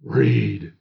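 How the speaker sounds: very low, deep swami voice; one word, dropped deep, as a guru instructing; swami's instruction. A narrator's reading. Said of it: "He would point to passages and say".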